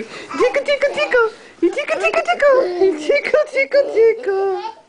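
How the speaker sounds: high laughing tickle chant; giggly tickle attack. Real, not acted. Mother tickling baby, laughing.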